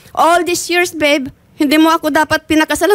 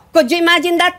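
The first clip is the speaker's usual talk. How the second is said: normal talking voice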